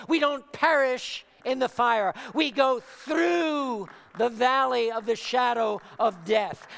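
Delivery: rousing, emphatic preacher's build; preaching at full force. Acted. Preacher encouraging believers through trials, preaching at full force. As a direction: drive each phrase home to the congregation.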